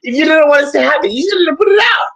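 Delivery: high, crying mock lament; mock wail. Fan wails at [name] for pulling the song. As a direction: wail it like a hammed-up heartbreak.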